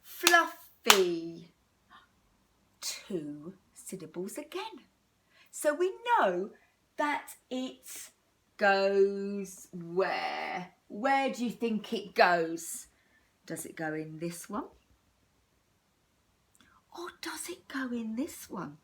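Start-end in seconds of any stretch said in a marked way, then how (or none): 0.0-1.5 FLUF-fy in two loud beats; clapped syllables. Shown as a demonstration. Teacher claps out Fluffy for children, louder beats. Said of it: "Let's go". 17.0-18.8 a hushed whispered hint; whispered question. Shown as a demonstration. Teacher whispers the choice to children, hushed.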